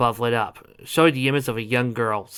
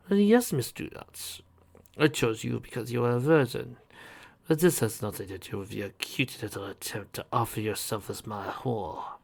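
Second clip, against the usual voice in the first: sinister man voice; 5 dB quieter than usual. Acted. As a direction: sneer quietly. Captor voice.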